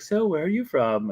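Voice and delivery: a hint of put-on nanny accent; Mary Poppins. Nanny asking diners where they are from, lightly put-on voice. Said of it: "And she's like".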